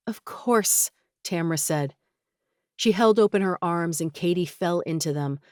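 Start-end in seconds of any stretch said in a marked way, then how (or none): 0.1-0.9 whisper. A narrator's reading. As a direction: breathe it out, tearful. Tearful reconciliation, whispered reply.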